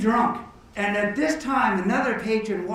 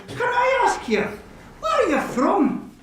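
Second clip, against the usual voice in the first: high-pitched joke-character question; hammed-up friendly inquiry; eager curiosity; 12 semitones higher than usual. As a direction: ask keenly, voice pitched way up.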